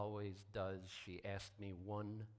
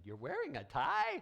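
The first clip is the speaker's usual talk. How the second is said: mock astonished question, pitched higher and louder; surprised; 8 semitones higher, 7 dB louder than usual. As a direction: play the congregation's amused disbelief. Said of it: "everyone said".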